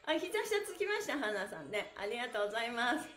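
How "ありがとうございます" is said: normal talking voice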